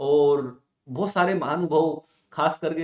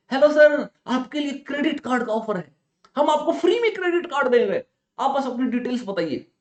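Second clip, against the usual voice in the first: high bright sales pitch, louder at start; cheerful telecaller; 7 semitones higher, 3 dB louder than usual. Retold or imitated. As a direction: pitch the free card like a chirpy caller. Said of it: "बड़ी खुश आवाज में".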